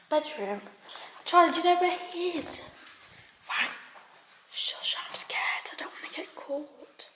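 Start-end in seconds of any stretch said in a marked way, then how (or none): 4.5-7.1 hushed, frightened whisper; scared whisper. Real, not acted. Girl sneaking upstairs at night, whispering scared to viewers. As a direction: whisper so the parents don't wake.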